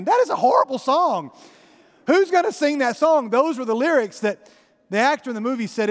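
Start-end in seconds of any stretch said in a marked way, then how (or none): 0.0-3.3 higher, louder mock shock; mock dismay. Preacher voices the listeners' horror at the grim lyrics, mock appalled. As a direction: voice the crowd's horrified reaction. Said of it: "You're probably thinking".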